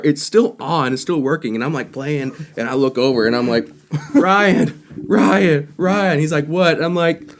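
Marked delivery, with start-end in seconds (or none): 4.1-6.2 high, loud repeated name call; urgent calling out. Retold or imitated. Drummer to bassist whose amp is on fire, urgent re-enacted shout. 6.5-6.8 high, loud, short question; imitated shouted reply. Bassist answering the drummer mid-gig, imitated loud reply.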